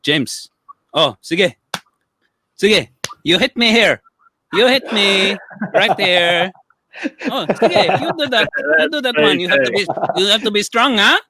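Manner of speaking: put-on high-pitched old uncle with a Filipino accent; Uncle Tony. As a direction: goad slowly in a thick Filipino accent. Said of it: "my uncle Tony".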